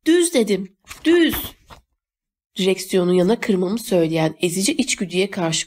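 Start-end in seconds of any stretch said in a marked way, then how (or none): 0.1-0.3 short, urgent high call; called-out command. A narrator's reading. Insisting on the course, about to crash. 1.0-1.4 high, urgent shout; shouted command. A narrator's reading. Repeated order before the crash.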